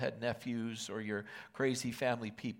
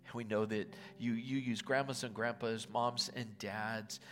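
prayer; 4 dB quieter than usual. Real, not acted. Pastor praying over the congregation at Thanksgiving, hushed and earnest. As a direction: pray it softly and sincerely over the people.

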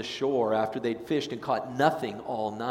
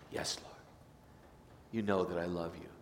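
soft answer; 5 semitones lower, 10 dB quieter than usual. Acted. Peter answering Jesus, ashamed.

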